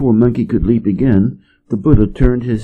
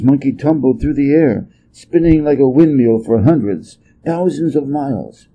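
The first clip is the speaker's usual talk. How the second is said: plain reading voice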